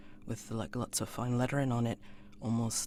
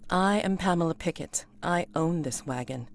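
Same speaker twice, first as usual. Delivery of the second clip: lady introducing herself; 5 semitones higher, 6 dB louder than usual. Acted.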